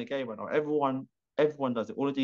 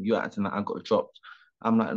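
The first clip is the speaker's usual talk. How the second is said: usual speaking voice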